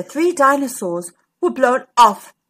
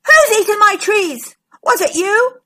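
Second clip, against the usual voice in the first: high, loud accusing dinosaur; indignant storybook shout; angry shout; 10 semitones higher, 7 dB louder than usual. A narrator's reading. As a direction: shout the accusation in a raised pitch. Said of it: "Diplodocus shouted at Triceratops".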